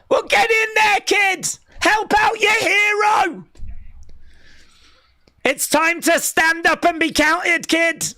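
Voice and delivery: rally the little fan like a ringside hype man; mock rallying shout. Urging kid to help hero.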